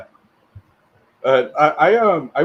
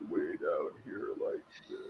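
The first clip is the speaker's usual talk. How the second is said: deep, quiet, dropped low; 6 semitones lower, 16 dB quieter than usual. Shown as a demonstration. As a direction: push the voice way down. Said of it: "I've lost that ability".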